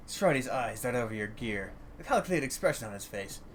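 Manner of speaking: ordinary delivery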